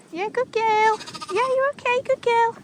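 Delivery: high-pitched reassuring dog talk; cooing to the dog. Real, not acted. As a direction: soothe the dog up high like talking to a pet. Reassuring the dog while riding.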